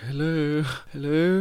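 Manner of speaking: high, sing-out mock hello; retold high-pitched greeting call; tentative calling out. Calling out on arrival at a house.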